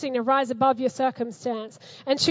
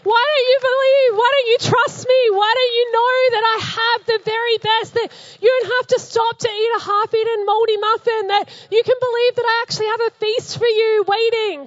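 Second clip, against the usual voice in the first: God pleading; 7 semitones higher, 6 dB louder than usual. Retold or imitated. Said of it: "he's just sitting there going".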